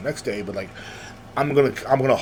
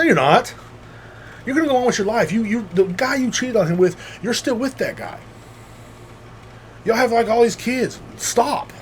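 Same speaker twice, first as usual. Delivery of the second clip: higher, heated telling-off; angry retort; 9 semitones higher than usual. Retold or imitated. Friend telling off the cheating ex, heated. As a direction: snap back at her fake sympathy.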